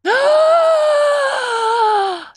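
long high-pitched gasping squeal; shrieking gasp. Real, not acted. Host reacting to co-host's joke, shrieking gasp. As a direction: let out a drawn-out high shriek. Shocked squeal during banter.

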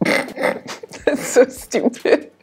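slow, breathy laughing. Real, not acted. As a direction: laugh it out, amused. Laughing at a pun.

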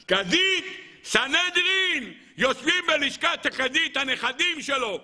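high, drawn-out preacher's proclamation; exclaiming. Real, not acted. Preacher marvelling at Jethro's reward, high exclamation. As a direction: proclaim it high, stretching key words.

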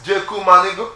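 high, loud rising shout-out. Real, not acted. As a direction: sign off with a big call to your friend. Shout-out ending a tribute.

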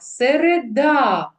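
"Середа" with the final "да" stressed and stretched; stress on last syllable. Shown as a demonstration. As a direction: lean hard and long on the last syllable of "Середа". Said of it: "поставимо наголоси в словах".

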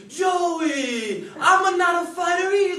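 put-on big loud Italian uncle accent; thick Italian accent. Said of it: "He said to me".

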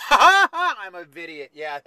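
loud, high laugh into the words; drawn-out, big laughing delivery. Real, not acted. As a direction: burst out laughing at your own joke. Laughing at own pun.

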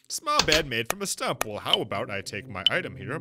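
usual speaking voice

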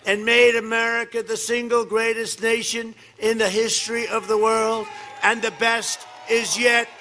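booming campaign rally delivery; rally speech. Acted. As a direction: shout it to a packed arena.

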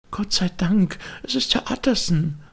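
put-on high, relieved old maid crying out; relieved old woman. A narrator's reading. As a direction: call out with relief, pitched very high. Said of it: "rief die alte".

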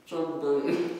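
words round a mouthful of marshmallow; mouth full. Real, not acted. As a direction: say it with cheeks stuffed full.

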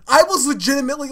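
ordinary talk

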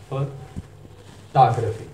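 फोटोग्राफी very slowly as fuh ... TAHG-ruh-fee; American pronunciation. Shown as a demonstration. Teacher modelling American speech, slow fuh-TAHG-ruh-fee for फोटोग्राफी. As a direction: pause after "fuh", open "tah" in फोटोग्राफी. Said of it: "और American pronunciation".

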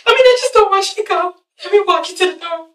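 high, hurried tearful pleading; imitating a whiny, weepy plea; mock crying. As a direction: rush it out as if bursting into tears. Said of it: "and then she starts crying".

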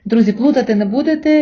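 usual speaking voice

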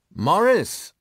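loud, high call across the room; high-pitched loud shout. A narrator's reading. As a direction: holler the name to get his attention. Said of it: "rief er".

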